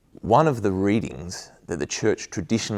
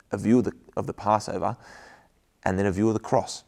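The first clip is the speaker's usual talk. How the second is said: ordinary talk, nothing marked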